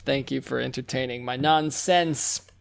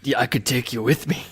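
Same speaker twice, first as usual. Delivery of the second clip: low, menacing character voice drifting toward Liam Neeson; the Hound; 5 semitones lower than usual. Shown as a demonstration. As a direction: offer it as a low tough-guy impression. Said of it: "That's pretty Liam Neeson".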